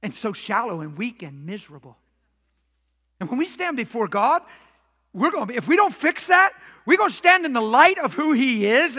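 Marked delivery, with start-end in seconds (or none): 3.2-9.0 loud, raised preacher's delivery; full-force preaching. Real, not acted. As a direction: drive it home to the congregation, loud and high.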